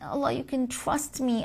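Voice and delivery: usual speaking voice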